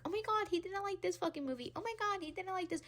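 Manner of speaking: high, gasping mock outrage; hammed-up scandalized gossip. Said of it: "everyone was like".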